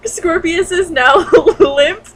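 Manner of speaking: slow, loud, laughing mockery. Real, not acted. Reader mocking the fanfic ending, laughing loudly. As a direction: giggle out limp peni.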